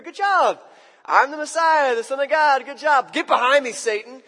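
a loud, mock-cheery then scolding Jesus; mocking whiplash. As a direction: send up the sudden change of tone. It seems like a quick shift.

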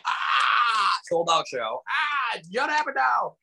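high cheering crowd imitation. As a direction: mimic the crowd going wild. Crowd goes wild for Judd.

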